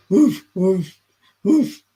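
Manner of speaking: loud big-dog woofs; short punchy old-dog imitation. Shown as a demonstration. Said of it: "That's a very old, big dog".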